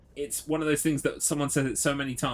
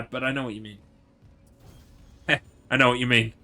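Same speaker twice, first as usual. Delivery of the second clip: ordinary talk, nothing marked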